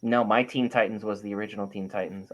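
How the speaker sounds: ordinary talk, nothing marked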